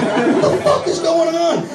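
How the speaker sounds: high, drawn-out comic wail; panicked bewilderment. Acted. Dad lost among pad aisles, high panicked wail. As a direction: wail it high and slow, overwhelmed.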